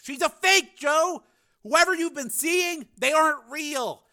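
high, insistent pleading; urgent persuasion. A narrator's reading. Frank convincing his brother, urgent persuasion. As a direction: press each point home urgently.

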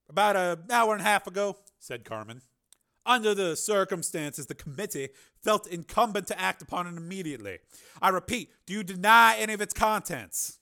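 high, loud, commanding senator; Carmen, senator's voice. Acted. Senator announcing the engineer's name to the committee, commanding. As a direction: announce it with cold authority.

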